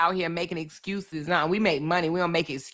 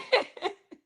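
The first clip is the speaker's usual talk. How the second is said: high squealing laugh; 11 semitones higher than usual. Real, not acted. Laughing hard at own story.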